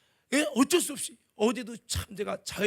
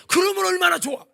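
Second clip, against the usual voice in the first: usual speaking voice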